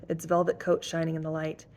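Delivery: ordinary delivery, nothing marked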